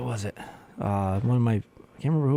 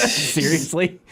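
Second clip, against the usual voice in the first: ordinary talk, nothing marked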